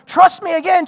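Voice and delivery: loud, high trust me again; insistent plea. Retold or imitated. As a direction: push hard for trust right now. Spouse pleading for trust.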